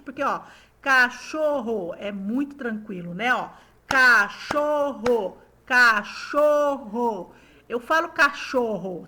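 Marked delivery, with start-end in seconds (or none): 0.8-1.9 CACHORRO in three beats, ca-cho-rro; measured CA, CHO, RRO; syllable by syllable. Shown as a demonstration. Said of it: "fizeram corretamente". 3.9-7.3 CACHORRO slowly in three beats, ca-cho-rro; drawn-out CA, CHO, RRO for counting; syllable by syllable. Shown as a demonstration. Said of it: "eu abro três vezes pra falar".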